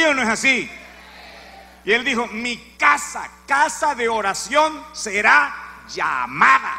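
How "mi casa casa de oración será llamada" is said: slow, weighty Mi casa; louder, slowed proclamation; solemn declaration. Acted. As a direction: pronounce it like scripture. Christ quoted solemnly.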